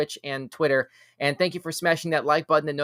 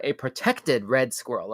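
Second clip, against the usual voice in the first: normal talking voice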